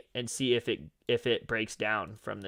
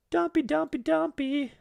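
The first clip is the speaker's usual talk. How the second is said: singsong; slower than usual. Acted. Singsong about prices dumping.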